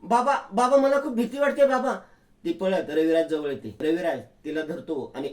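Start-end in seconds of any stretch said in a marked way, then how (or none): 0.0-2.0 loud, high panicked calls for father; frightened shouting. Acted. Girl screaming for her father in fear. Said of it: "जोर जोरात ओरडायला सुरुवात करते".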